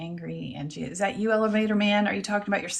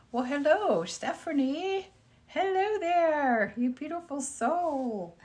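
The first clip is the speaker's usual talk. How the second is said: much higher, slow, drawn-out hello; sing-song greeting; 8 semitones higher, slower than usual. Pretend. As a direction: croon the hello up high and slow. Sweetly greeting a viewer in chat.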